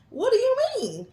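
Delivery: high, loud, alarmed question. Retold or imitated. Wife to son on the phone, alarmed and high. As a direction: shoot it up in alarm.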